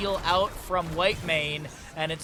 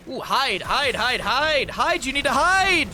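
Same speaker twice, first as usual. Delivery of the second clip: rising louder, higher urging, slowing at the end; urgent hide; 7 semitones higher, 5 dB louder, slower than usual. Real, not acted. Caster urging a hero to hide, building excitement. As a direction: build from a hiss of hope to a plea.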